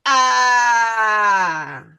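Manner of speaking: long, loud stretched "Ah.". Shown as a demonstration. Teacher to pupils, stretching the vowel: long loud "Ah.". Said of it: "then stretch it".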